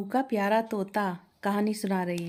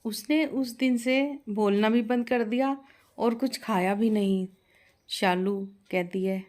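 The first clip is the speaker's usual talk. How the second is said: usual speaking voice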